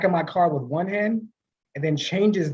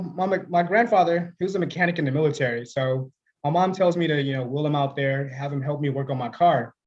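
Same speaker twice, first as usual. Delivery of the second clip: ordinary delivery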